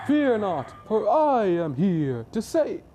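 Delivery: loud, high heroic proclamation; booming superhero. Acted. All Might arriving to save, loud heroic proclamation. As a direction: announce yourself grandly, drawing out the words.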